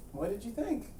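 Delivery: ordinary talk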